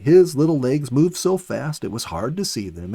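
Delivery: plain reading voice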